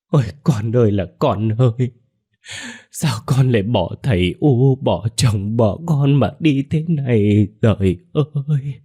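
put-on keen for a lost child, stretching the last cries; plaintive wailing. A narrator's reading. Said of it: "Bà Hồng cũng ai oán kêu lên". (kêu khóc ai oán)

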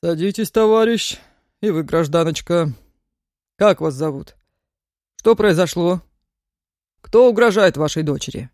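measured official questioning; a hint of a grave chief; stern old officer.

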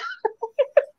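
short high-pitched giggle. Real, not acted. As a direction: let out a quick high giggle. Laughs after blocked joke.

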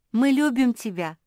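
loud, high chorused affection; all in chorus. A narrator's reading. Family tells Куки they love her, calling out in chorus. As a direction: call it out like a whole group. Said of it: "хором сказали все".

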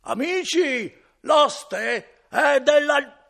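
very high, very loud, drawn-out yell; yelling to friends. Acted. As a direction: call down the stairs at the top of his lungs. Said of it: "cominciava a urlare più forte ancora".

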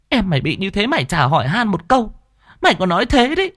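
high, rising shrill reproach; shrieking scold. A narrator's reading. A mother shrieks at her uncaring son. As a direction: screech at the lazy son. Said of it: "Bà bướm cũng rít lên".

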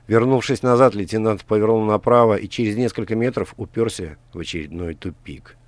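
ordinary delivery

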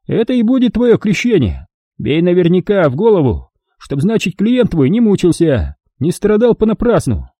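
put-on high, cheerful cruel old man. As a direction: play a folksy sadistic old prospector. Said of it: "предупредил Лунжаков".

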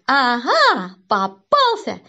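громкое торжествующее восклицание; обрадуйся, что поймал, громко и протяжно; радостно. A narrator's reading. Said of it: "Он увидел меня и обрадовался". (торжествующе)

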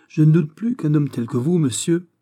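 earnest and emphatic; serious. A narrator's reading. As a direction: state it seriously. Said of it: "dit sérieusement Aramis".

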